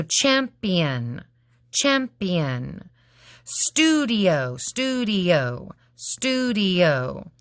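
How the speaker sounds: champion stretched slowly, cham-pi-on; slow syllables. Shown as a demonstration. Teacher to class, showing the schwa in champion slowly. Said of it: "this is that schwa".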